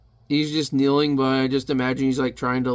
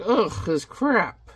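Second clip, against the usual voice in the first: drawn-out, exasperated groaning complaint; hammed-up fed-up grumble, pitch swooping; mock disgust; 5 semitones higher, much slower than usual. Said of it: "this guy's all like".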